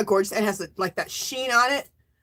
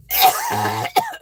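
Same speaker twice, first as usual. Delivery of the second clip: harsh choking cough fit; violent coughing; 3 dB louder than usual. Real, not acted. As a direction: cough hard like water went down wrong.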